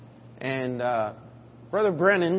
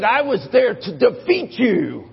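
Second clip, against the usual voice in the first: louder, high, slow and firm; firm retort; 6 semitones higher, 9 dB louder than usual. Retold or imitated. Old veteran tells the skinhead he came to defeat him, stern and slow. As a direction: lay down the fact like a veteran who won. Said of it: "He said".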